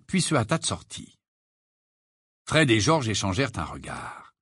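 plain reading voice

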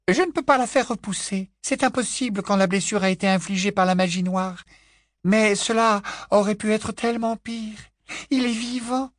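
high-pitched motherly character voice; worried mother. A narrator's reading. Mrs Weasley reports on her wounded son, high worried voice. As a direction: keep it high, anxious but holding together.

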